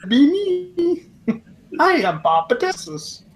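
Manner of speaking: high doll voice through a laugh; pull string toy. Pretend. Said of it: "the next time you pull".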